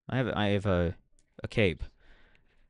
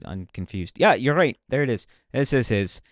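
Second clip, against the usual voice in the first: ordinary talk, nothing marked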